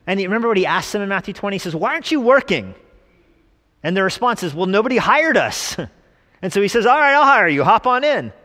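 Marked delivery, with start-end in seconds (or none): 1.8-2.7 high, louder acted question; lightly voiced landowner. Acted landowner questions idle workers. 4.6-5.8 high, louder mock protest; lightly voiced workers. Acted workers answer the landowner. 6.9-8.3 high, loud hearty hiring call; landowner calling out. Retold or imitated. Acted landowner hires the workers.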